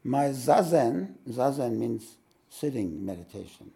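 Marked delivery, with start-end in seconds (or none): 0.0-1.1 mock student lament. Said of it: "When you say".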